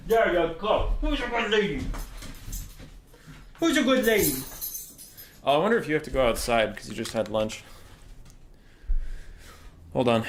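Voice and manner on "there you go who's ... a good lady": pet praise. Real, not acted. Praising the dog.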